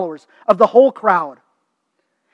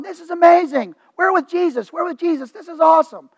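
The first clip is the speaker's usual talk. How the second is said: higher-pitched imitation of thrilled followers; excited crowd; 5 semitones higher than usual. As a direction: voice an amazed crowd cheering Jesus on, without shouting. Acting out crowd thrilled by miracle.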